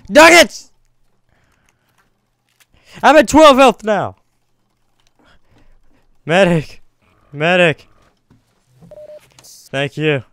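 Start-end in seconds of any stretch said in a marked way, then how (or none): 0.1-4.2 high, loud panicked yell; screaming for help, hit hard; man down shout. Real, not acted. Wounded, calling teammate for help. 6.3-7.9 loud urgent call, Medic. Real, not acted. Wounded, calling for medic.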